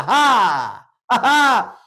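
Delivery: loud demo laugh; two big ha has, full of air; hearty ha ha. Shown as a demonstration. Lecturer leading the crowd in a loud laughing exercise. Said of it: "저를 보시고 따라합니다".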